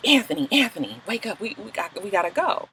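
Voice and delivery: softly urging someone awake; quiet, halting retold nudge to wake up; hushed wake-up. Waking Anthony in the dark, hushed. Retelling waking him up at night.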